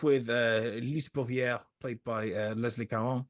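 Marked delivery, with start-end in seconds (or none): 0.8-2.5 French accent. Shown as a demonstration. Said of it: "I'm trying to do a French accent". 2.5-3.2 Leslie Caron with a mild French accent, dropped final letter. Shown as a demonstration. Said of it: "I'm trying to do a French accent".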